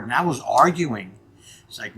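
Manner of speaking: normal talking voice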